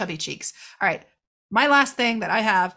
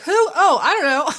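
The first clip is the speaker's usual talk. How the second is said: mock careless shrug; 7 semitones higher, 6 dB louder than usual. Careless Tad retold.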